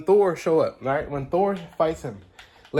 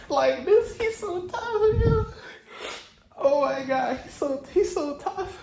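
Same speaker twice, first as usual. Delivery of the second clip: much higher, crying-laughing squeal of awe; fangirl squeal; 11 semitones higher than usual. Real, not acted. Fan squealing at the trailer villain, tearful excited squeal. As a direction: squeal with delight, near tears.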